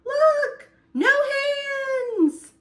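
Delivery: high, loud show-off cry; thrilled shout. Acted. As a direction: brag at the top of your voice. Acorn rolling down the hill.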